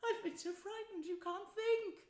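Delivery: high-pitched frightened confession; trembling, exhausted. A narrator's reading. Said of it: "exhausted and still trembling".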